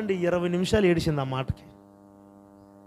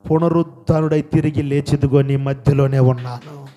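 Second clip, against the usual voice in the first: low, loud proclamation as the Lord; voicing the Lord; 5 semitones lower, 9 dB louder than usual. Risen Lord declaring his presence to believers, low loud proclamation.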